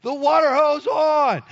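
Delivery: high, drawn-out holler; urgent slow shout, pitched high; retold yell. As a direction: yell it slowly across the backyard to your wife. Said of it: "I yell at my wife".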